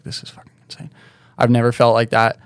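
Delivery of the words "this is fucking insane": hushed, much softer, in disbelief; awed whisper. Retold or imitated. Overwhelmed after landing the trick twice, an awed whisper. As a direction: breathe it out like you can't believe it.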